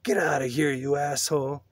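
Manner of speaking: understated angry holler; angry scream. A narrator's reading. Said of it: "Lester screamed".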